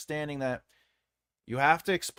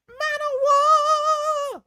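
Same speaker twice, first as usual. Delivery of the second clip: squeal; 9 dB louder, much slower than usual. Acted. Squealed high joke line.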